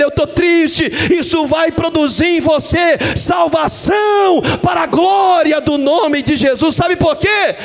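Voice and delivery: fervent sermon shout; preaching at full force. Acted. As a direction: declare it to the whole church. Preaching climax.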